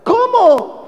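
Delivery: Paul's astonished outcry at the division; shocked disbelief. Shown as a demonstration. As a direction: react as if you can't believe it. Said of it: "entonces ya entiendes al apóstol Pablo".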